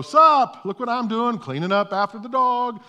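high, loud sheepish cover-up; caught, acting casual. Caught by the neighbor with the dog mess, high loud casual cover. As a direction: brightly pretend it was the plan all along.

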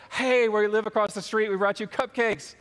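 acted eager visitor; cheery doorstep greeting.

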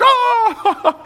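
high, loud burst of laughing surprise; a sudden yelp as the penny drops; surprised laugh. Retold or imitated. Parole officer realizing it's true, yelping with laughing surprise.

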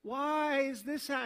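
begging heaven for an answer, voice pushed up; desperate plea to God. Retold or imitated. Son at mother's deathbed crying out to God, pleading loudly. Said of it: "where I'm like".

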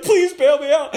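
high, loud comic begging; mock desperate plea. Preacher begging God to back his claim, mock desperate. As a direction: plead urgently to be rescued.

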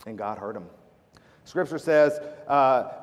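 ordinary talk

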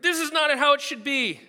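a diner's hammed-up huff over the food; indignant outburst. Complaining about restaurant food.

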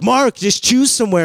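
high, loud impatient yell; siblings yelling. Retold or imitated. Siblings yell at their indecisive brother, high and loud. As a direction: shout at him from the back seat. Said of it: "they're yelling at me".

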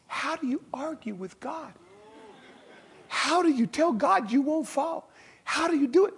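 high, loud disbelief; incredulous exclamation. Acted. Preacher asking the congregation in high disbelief.

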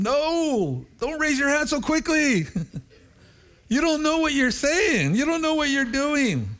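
high, pressing plea not to rush; high urgent warning. Retold or imitated. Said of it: "I tell them".

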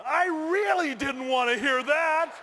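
high, loud, slow protest; dismayed outburst. Retold or imitated. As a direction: wail it out slowly in comic dismay. Comic dismay at instructor's news.

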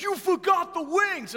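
high, slow yell of an upset best man; Vito yelling. Retold or imitated. Best man yelling at the pastor across the aisle, high and urgent. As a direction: shout it up high like a big guy panicking. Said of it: "He's like".

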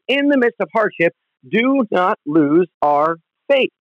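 normal talking voice

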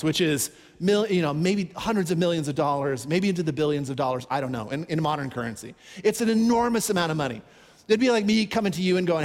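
ordinary delivery

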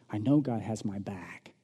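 hushed whisper of trust; a real intimate whisper. Real, not acted. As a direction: breathe it out as a private confession. Closing personal confession.

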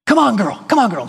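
a light high sing-song call to a dog; coaxing a dog. Retold or imitated. Preacher acting out calling his dog inside, mild coaxing. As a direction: coax her in like a reluctant dog. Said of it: "Coax her".

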